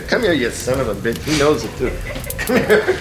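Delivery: ordinary delivery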